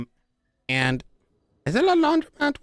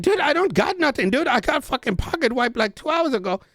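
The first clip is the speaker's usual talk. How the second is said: much higher, hammed-up panic; mock distressed protest; 10 semitones higher than usual. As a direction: whine it out in a pitched-up panic.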